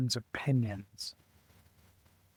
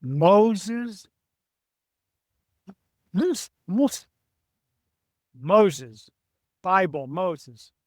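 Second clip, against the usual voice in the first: incredulous; 5 semitones higher, 4 dB louder, much slower than usual. Retold or imitated. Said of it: "And I said".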